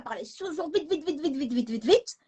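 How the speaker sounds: high, racing repetition of vite; imitating speedy talking. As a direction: rattle it off like a machine gun. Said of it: "très vite".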